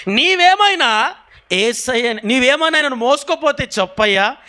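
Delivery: high-pitched begging; urgent pleading. Acted. Mary begs the gardener to tell her, pleading.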